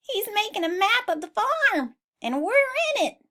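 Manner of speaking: high sheep voice bursting with news; excited sheep cry. Acted. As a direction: crow it like proud sheep. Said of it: "the sheep cried".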